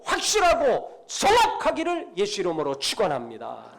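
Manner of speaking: high, forceful preaching with a falling close; full-force preaching. Real, not acted. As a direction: punch each key word like a pulpit blessing. Preaching climax and blessing.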